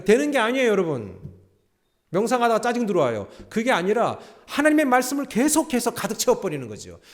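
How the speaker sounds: loud, high pulpit preaching; full-force preaching. Acted. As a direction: drive the point home to the congregation. (높고 크게 힘찬 설교)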